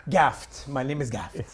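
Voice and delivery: Russian-accented waiter introducing himself; comic Russian accent. Shown as a demonstration. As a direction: give the name in a Russian accent. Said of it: "that was a Russian accent?".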